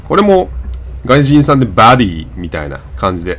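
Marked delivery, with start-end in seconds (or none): 1.7-2.3 バディ with an open 'ah' in place of o; English 'body' as 'bah-dy'; American pronunciation. Shown as a demonstration. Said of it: "あに近い発音ですよね".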